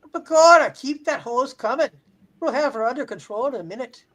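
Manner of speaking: loud stage-Irish brogue; Irish fireman voice. Pretend. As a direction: bark orders like a leprechaun fireman. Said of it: "Irish firemen".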